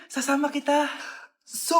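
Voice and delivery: usual speaking voice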